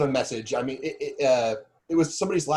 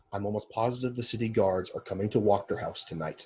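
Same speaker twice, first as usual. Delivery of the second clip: low, soft confidential warning; very hushed; 4 semitones lower, 6 dB quieter than usual. Acted. Warning that city guards are coming, very hushed. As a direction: keep it down so only they hear. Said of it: "I'm saying this very hushed".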